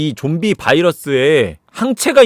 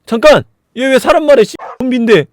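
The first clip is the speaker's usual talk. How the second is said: loud, high exclamation; acted alarm, voice raised; startled soldier; 8 semitones higher, 7 dB louder than usual. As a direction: blurt it out in disbelief. Soldiers startled that zombie talks.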